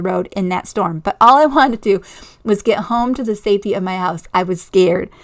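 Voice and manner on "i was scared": usual speaking voice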